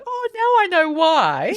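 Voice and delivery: high, drawn-out "aha" moment; dawning realization. Retold or imitated. Patient recognizing her surgeon's lookalike, sudden realization.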